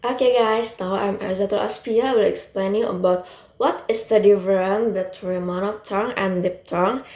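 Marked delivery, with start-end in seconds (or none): none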